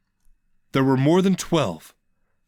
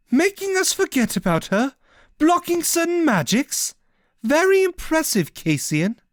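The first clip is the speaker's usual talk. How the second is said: higher, mildly sarcastic; sarcastic heroine. Acted. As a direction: needle her with dry praise. Ishel confronts Cassian.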